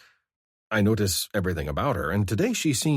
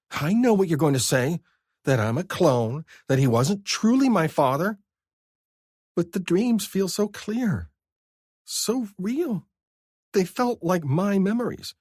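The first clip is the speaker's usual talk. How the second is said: higher, louder defensive woman's voice; Vivi defensive; 12 semitones higher, 4 dB louder than usual. A narrator's reading. Vivi defending her dreams to Scar, high and defensive. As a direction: insist on it, then go wistful.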